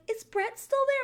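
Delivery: very high, loud imitation; squeaky put-on questioning voice; mock clueless sleeper. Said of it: "And then she's like".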